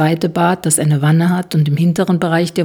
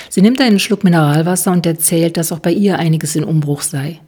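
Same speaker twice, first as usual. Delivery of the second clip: plain reading voice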